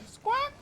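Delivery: high, loud spoken squawk; mock parrot cry. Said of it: "I'm doing a parrot voice acting now".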